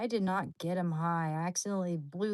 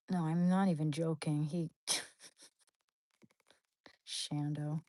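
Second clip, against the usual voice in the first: usual speaking voice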